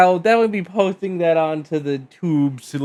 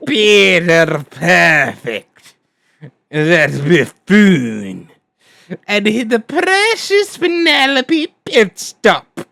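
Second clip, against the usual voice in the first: evil villain voice; 2 semitones higher than usual. Acted. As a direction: sneer it like a scheming villain. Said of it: "my evil villain voice".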